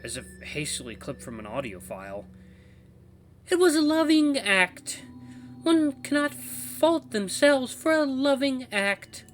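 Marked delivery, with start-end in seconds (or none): 3.5-9.2 loud, very high, slow falsetto; choppy woman's voice. Acted. Walkie voice defends a loving act, choppy high woman's voice. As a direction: deliver it high and halting, like clipped audio. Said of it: "start coming out even choppier".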